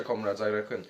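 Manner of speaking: put-on comrades dropped low and soft in a jokey Russian accent; a deep joking Russian comrade tag; deep mock Russian. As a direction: sink into a deep Soviet growl on comrades. Reactor's running Russian joke.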